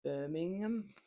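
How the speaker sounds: Birmingham said in a faint Brummie accent; lightly put-on Brummie accent; Brummie attempt. Shown as a demonstration. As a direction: offer your one Brummie word, half-joking. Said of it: "I can only say one word in Brummie".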